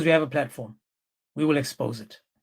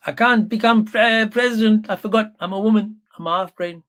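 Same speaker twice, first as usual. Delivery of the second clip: higher, louder mocking impression of a submissive wife; mock woman voice; 5 semitones higher, 4 dB louder than usual. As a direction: sneer it out as a dim, meek woman.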